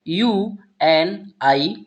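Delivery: slow, letter-by-letter spelling; teacher spelling out a word. Shown as a demonstration. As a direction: spell each letter slowly for learners. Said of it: "Hindi उच्चारण जो होगा".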